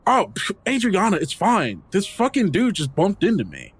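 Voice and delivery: high, whiny brush-off; dismissive teen. Acted. Dylan brushes off Adriana and blames Delroy, high and whiny. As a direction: wave her off with a scoff.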